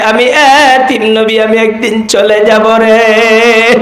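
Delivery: tearful-style chanted storytelling; imitated preacher's melodic wail; Tofazzol Bhairabi impression. Shown as a demonstration. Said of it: "ওনার মতো হুবহু হয়েছে তো".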